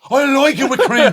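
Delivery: hammed-up Irish bird. Irish wife screaming at her Italian husband over spaghetti. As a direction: shriek back like an outraged Irish wife. Pretend marital row.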